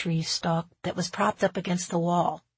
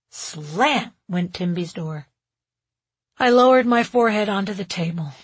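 ordinary delivery, nothing marked

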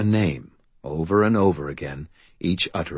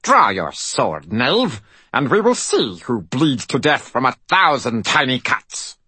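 high, loud piping fairy voice; tiny hammy Shakespearean actor on helium; shrill helium voice; 7 semitones higher, 4 dB louder than usual. A narrator's reading. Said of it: "like a Shakespearean actor on helium".

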